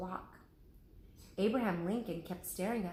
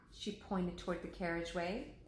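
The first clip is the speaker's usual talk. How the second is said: ordinary delivery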